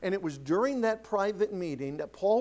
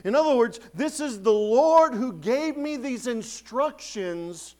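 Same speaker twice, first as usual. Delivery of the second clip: rising, louder pulpit preaching, slowing; 6 semitones higher, 8 dB louder, slower than usual. Acted. Pastor urging the church to follow the Lord, fervent preaching. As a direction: press the point home from the pulpit.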